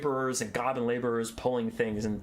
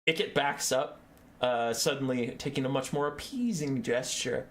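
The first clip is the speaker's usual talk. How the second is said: ordinary talk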